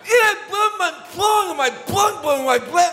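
high, loud garbled shout; frantic full-voice hollering, acted out; electrocuted yelling. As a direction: yell slurred words as if just zapped. Acts out being electrocuted.

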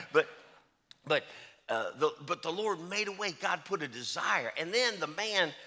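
ordinary talk, nothing marked

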